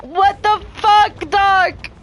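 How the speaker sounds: high, shrill-pitched panicked yell at Doc; panicked shriek. Retold or imitated. Gamer yelling at Doc that he lied about the water. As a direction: screech it like the monster is right there. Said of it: "I'm like".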